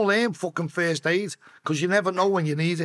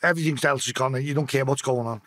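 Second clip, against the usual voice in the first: ordinary talk, nothing marked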